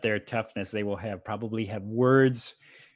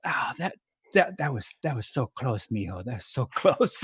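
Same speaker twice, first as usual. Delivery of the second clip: veteran wrestler's hushed, strained voice; softly commiserate, drawing back the volume; Cesar Garrido; 5 dB quieter than usual. Acted. Veteran patting younger wrestler backstage, soft put-on commiseration. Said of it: "the veteran, is like".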